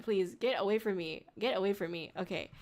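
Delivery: ordinary talk, nothing marked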